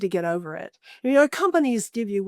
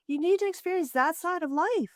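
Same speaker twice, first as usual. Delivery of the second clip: ordinary talk, nothing marked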